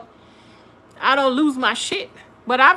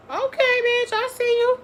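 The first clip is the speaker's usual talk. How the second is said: squealing high, hyped up; big excited I-see-you; 12 semitones higher than usual. Real, not acted. Hyped reaction to explanation.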